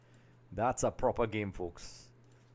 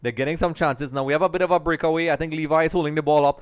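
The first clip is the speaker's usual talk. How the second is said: loud, quick call rising to a shout; live play-by-play; 4 semitones higher, 10 dB louder than usual. Real, not acted. Commentator follows a breakaway for viewers, rising excited play-by-play. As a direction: build excitedly to a high, loud finish.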